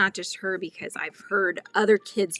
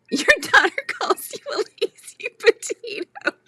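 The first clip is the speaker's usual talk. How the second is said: normal talking voice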